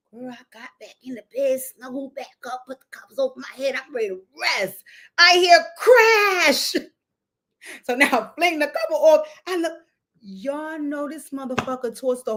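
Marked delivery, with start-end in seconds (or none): 5.2-6.7 slow, loud and high, stretching each word; dramatic loud crash. Retold or imitated. Telling viewers about the crash, loud and drawn out. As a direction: hit it like the crash just landed.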